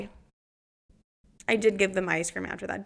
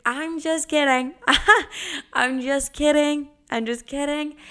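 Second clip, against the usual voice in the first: teasing laugh; 6 semitones higher, 3 dB louder than usual. Retold or imitated. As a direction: laugh it off while mocking them. Teasing scared kids.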